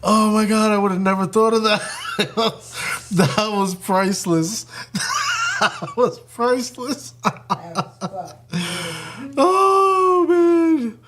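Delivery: high, laughing squeals between words; helpless with laughter; crying laughing. Real, not acted. Streamer losing it over the roast, high laughing. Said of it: "I'm crying over here".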